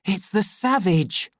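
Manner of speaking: high, loud exclamation of alarm; frightened. A narrator's reading. Official recognising the savage, frightened. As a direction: blurt it out in alarm. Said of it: "He felt frightened".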